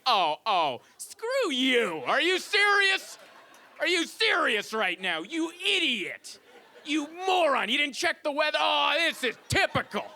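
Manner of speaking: high, drawn-out screechy tirade; mock furious wife. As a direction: yell hammed-up outrage at a useless husband. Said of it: "yelling back at her".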